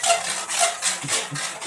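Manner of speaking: very high squealing laugh; a breathless squeak of laughter. Real, not acted. Almost knocked plate over.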